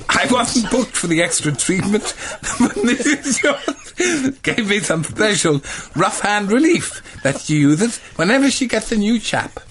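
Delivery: barely holding it together; giggling at the punchline; laughing through it. Real, not acted. Narrator delivering the rude punchline: laughing.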